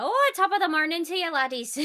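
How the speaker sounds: hammed-up cheery Irish hello; Irish accent. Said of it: "the Irish accent and the Scottish accent".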